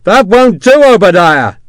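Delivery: loud taunt. Acted. Sharp calling down, taunting.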